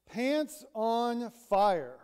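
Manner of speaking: slow, loud, flat-out accusation; blunt retort. Retold or imitated. Friend bluntly calls out Brown's fake cheer, slow and loud. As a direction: drag out the words as a teasing callout.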